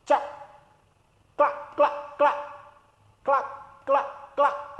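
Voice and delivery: soft high clucking like a hen. Shown as a demonstration. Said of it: "Hen says".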